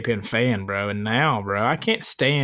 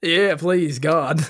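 normal talking voice

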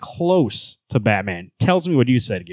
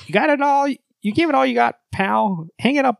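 high-pitched condescending hero; Superman; 11 semitones higher than usual. Acted. Hero dismissing Batman, high condescending voice. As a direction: pitch it high, talking down to him. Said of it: "a higher voice for Superman".

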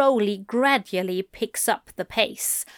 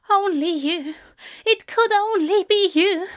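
high, rapturous lover's voice; impassioned Aziraphale, adoring; 10 semitones higher, 3 dB louder than usual. Acted. Aziraphale to lover, arms round neck, adoring. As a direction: declare it high, full of devotion.

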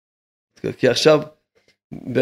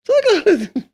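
high mocking voice. Rabbi scoffing at those arguing over two hundred shekels, high and mocking. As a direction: jump way up into a high, incredulous mocking pitch.

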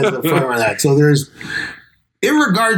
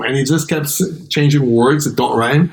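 ordinary talk

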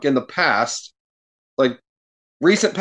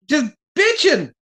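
exasperated high; 11 semitones higher than usual. Real, not acted. Exasperated rant about Page.

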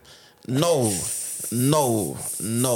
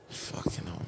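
breathy whispered curse under breath; 4 semitones lower, 11 dB quieter than usual. Real, not acted. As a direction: whisper it to yourself. Muttered aside after sigh.

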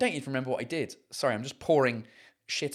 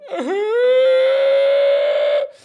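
long shrieking laugh, much higher and louder; big high laugh; 10 dB louder than usual. Real, not acted. Laughing at his own confession about drinking, loud and high.

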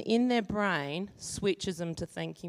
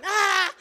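panicked cry; 11 semitones higher, 7 dB louder than usual. Retold or imitated. Parent panics back.

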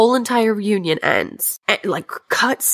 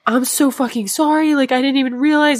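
higher-pitched mock-distressed apology; retold tearful apology; 7 semitones higher than usual. Crying man apologizes to the woman at the reunion, imitated pleading. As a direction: plead as if crying, without real sobs. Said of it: "He's crying and crying".

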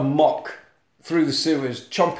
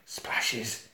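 ordinary talk, nothing marked

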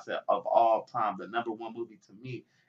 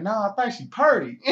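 loud, higher-pitched playful movie quote; movie character's line; 9 semitones higher, 7 dB louder than usual. Retold or imitated. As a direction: act out the film line with amusement. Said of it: "then he was like".

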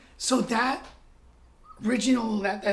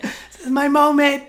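loud, laughing mock triumph; 7 semitones higher, 12 dB louder than usual. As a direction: crow it while laughing.

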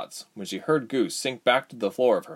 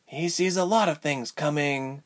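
higher-pitched, mildly bitter retort; bitterly; 6 semitones higher than usual. A narrator's reading. Ilse to Tyke about Rubens, high mildly bitter voice. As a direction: answer with a touch of bitterness. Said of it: "Ilse replied bitterly".

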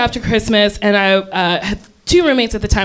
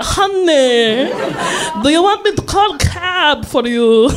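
put-on committed Greek accent; 2 semitones higher than usual. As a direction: go all in on the Greek waitress accent. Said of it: "I'm gonna commit".

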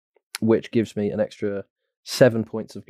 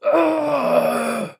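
pained cry. Acted. Character tased cry.

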